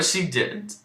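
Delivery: ordinary talk, nothing marked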